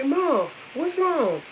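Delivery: high, drawn-out worried question; imitating concerned son. Son asking his crying mother, imitated concern. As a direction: imitate a child checking on mom, slowly.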